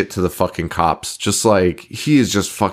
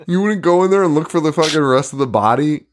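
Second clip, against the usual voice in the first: ordinary talk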